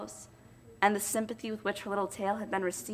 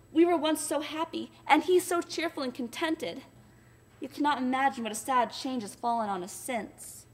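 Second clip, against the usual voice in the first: put-on higher, childlike sorrowful voice; young girl talking of her sad grandfather; little girl Nell; 5 semitones higher than usual. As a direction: speak as a grieving child. Nell's voice throughout.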